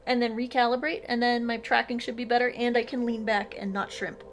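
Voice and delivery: ordinary talk, nothing marked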